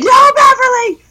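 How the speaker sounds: high, excited call across the room; excited shouting. Retold or imitated. Friend imitating her excited texts about the show. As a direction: yell it out like big news. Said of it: "you'd be like".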